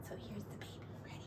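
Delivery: soft bedtime whisper. Real, not acted.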